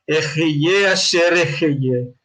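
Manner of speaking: "Ejeye asher ejeye" slowly, as a solemn Hebrew answer; Hebrew pronunciation. Acted. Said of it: "le está diciendo la pronunciación". ("Ejeye asher ejeye" como respuesta en hebreo, pausada)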